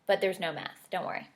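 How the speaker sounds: ordinary delivery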